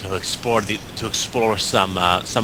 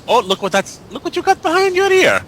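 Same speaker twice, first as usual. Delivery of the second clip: very high, playful sing-out; playful to a child; 4 dB louder than usual. Acted. Player showing a kid a coin trick, playful high voice. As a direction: coo it high, as to a small kid.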